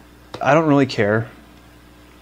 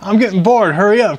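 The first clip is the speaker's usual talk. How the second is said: loud, high nagging to hurry; bored impatience; 7 semitones higher, 5 dB louder than usual. Pretend. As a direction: cut in loudly, pushing them along. Cohost hurries the slow storyteller along.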